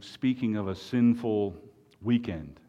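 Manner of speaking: normal talking voice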